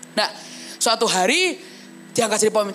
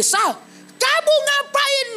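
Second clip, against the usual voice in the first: high, slow teacher's scolding question; stern teacher; 13 semitones higher, slower than usual. Retold or imitated. Teacher calling the student out in class, high and slow. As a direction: draw it out like a teacher catching a troublemaker.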